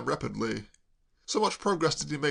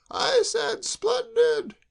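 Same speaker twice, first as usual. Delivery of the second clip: much higher and louder, slow and drawn out; roar; 6 dB louder, much slower than usual. A narrator's reading. Kay repeats her praise up the tree, roaring. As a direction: roar it so he hears you up high. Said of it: "roared Kay".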